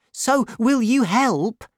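the anxious landlord calls out for help; crying out. A narrator's reading. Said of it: "cried Monsieur Bonacieux".

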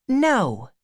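put-on high girlish character voice; Becky, young schoolgirl. A narrator's reading. Becky answering Tom's question about marrying, high girl's voice.

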